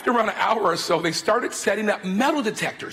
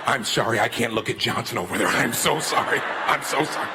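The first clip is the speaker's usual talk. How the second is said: a president who can't stop laughing; sorry between bursts of laughter; laughing apology; 9 semitones lower than usual. Retold or imitated. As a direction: lower voice, apologizing through chuckles. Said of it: "and starts laughing".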